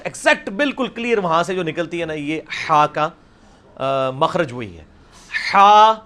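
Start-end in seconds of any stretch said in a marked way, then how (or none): 5.5-5.9 ہا from the middle of the throat, loud and breathy; throaty ha demo. Shown as a demonstration. Teacher drilling the Arabic ha for his class, loud and throaty. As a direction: push ہا out from mid-throat. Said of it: "یہ practice کرنی پڑے گی".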